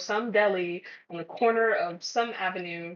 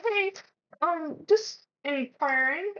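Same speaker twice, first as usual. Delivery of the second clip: much higher, slow and careful; overly polite inquiry; 8 semitones higher, slower than usual. Retold or imitated. Employee carefully asking the recruiter about the offer, overly polite. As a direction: tiptoe into the question.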